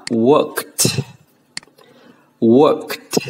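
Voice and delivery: "worked" ending in a crisp released t. Shown as a demonstration. Teacher models the ending for students, ED as T. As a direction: finish "worked" on "kt", no extra syllable. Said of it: "chúng ta sẽ phát âm là".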